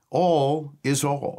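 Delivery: slow, both All words held and weighted; stress on All. Shown as a demonstration. Pastor emphasizing the hymn's word, slow weighted All. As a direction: dwell on each All as if it is everything. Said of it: "The word all".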